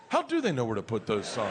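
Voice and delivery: low-pitched, earnest wondering; sincere pondering. Retold or imitated. Dad thinking to himself in the car, sincerely. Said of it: "thinking sincerely to myself".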